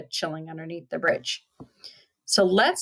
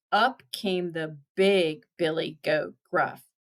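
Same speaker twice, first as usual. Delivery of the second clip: plain reading voice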